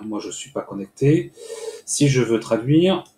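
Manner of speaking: normal talking voice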